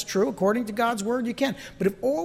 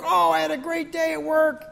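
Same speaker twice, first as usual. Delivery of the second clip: chirpy, higher; mock cheerful; 8 semitones higher than usual. Worker home from a good day, mock cheerful. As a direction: sell the great day. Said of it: "You had a good day at work".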